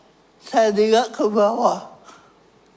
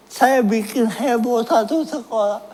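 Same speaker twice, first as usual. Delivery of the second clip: ordinary talk, nothing marked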